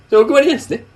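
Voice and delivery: a hammed-up older man talking down to a caller; imitating a pushy coworker; overbearing older man. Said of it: "そういう感じやって".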